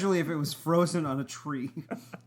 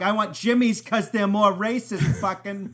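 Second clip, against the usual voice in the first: loud, higher mock customer voice; mock ordering; 5 semitones higher, 7 dB louder than usual. Mocking himself at the ice cream counter, loud and high. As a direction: ham up the order. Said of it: "And I'm like".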